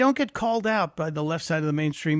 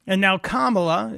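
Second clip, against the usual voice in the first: ordinary talk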